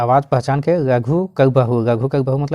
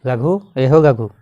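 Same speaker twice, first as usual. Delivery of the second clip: calling out; 3 dB louder, slower than usual. Retold or imitated. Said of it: "हकवे लागल और बुलाने लगा".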